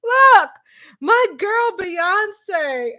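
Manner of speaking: high, loud burst, then drawn-out fangirl gushing; excited screaming. Real, not acted. Fan raves about her girl [name], excited screaming. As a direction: squeal over your favorite star. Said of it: "I'm so excited".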